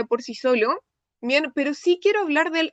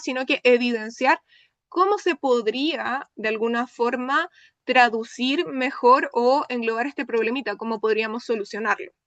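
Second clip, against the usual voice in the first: normal talking voice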